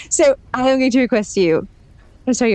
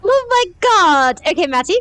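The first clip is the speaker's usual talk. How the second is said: excited; 9 semitones higher than usual. Acted. Delighted drunk exclamation.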